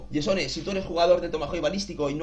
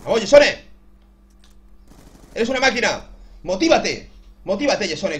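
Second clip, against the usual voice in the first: excited self-cheering; loud cheering; 2 semitones higher, 5 dB louder than usual. Real, not acted. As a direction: hype yourself up loudly. Cheering himself on in game.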